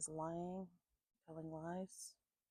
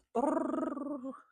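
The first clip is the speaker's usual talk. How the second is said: dog bay imitation; 8 semitones higher, 9 dB louder than usual. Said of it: "When she bays, she goes".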